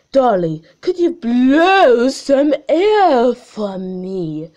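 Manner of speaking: slow and measured like a video scientist; slow, loud mock scientist. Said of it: "what do they sound like".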